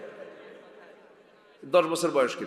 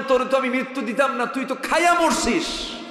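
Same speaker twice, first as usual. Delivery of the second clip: stern rebuke; 9 semitones higher, 4 dB louder than usual. Acted. God voiced rebuking smoker.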